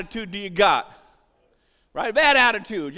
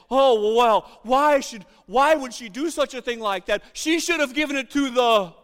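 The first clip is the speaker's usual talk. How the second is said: Judas; 2 semitones higher, 5 dB louder than usual. Acted. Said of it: "it seems like what Judas says".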